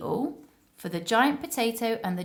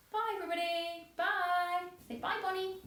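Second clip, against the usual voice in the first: singsong goodbye; 12 semitones higher, 7 dB quieter, faster than usual. Real, not acted. Saying goodbye to children.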